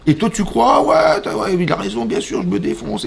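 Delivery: high, naive voice of someone taken in; mock eager agreement. Teacher mimicking a fooled student, high mock agreement. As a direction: play the gullible listener, pitched high.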